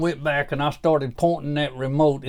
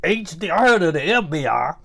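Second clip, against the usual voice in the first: normal talking voice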